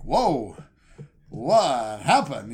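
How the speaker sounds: loud startled gasp of a question; stunned and slow; half-shouted shock at the door; shocked Gene. Retold or imitated. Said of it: "Gene's like".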